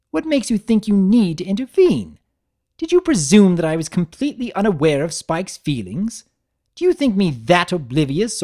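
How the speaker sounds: high, loud, cutting refined mare; Rarity voice. Acted. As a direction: play an offended fashion mare.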